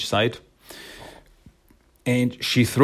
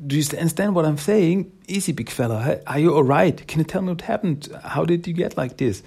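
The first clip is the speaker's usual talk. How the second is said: lightly acted, higher-pitched coaxing; tentative string of pitched-up questions; negotiating; 6 semitones higher, faster than usual. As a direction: talk down a big beast without raising your volume. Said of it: "Jennika tries to negotiate".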